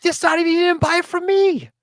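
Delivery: high, exasperated retort; disbelieving shop owner snapping back. Retold or imitated. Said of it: "I'm like".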